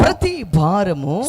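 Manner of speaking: loud and very slow scripture echo; proclaimed verse. Real, not acted. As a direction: stretch the words out at full volume. Echoes the reader's verse.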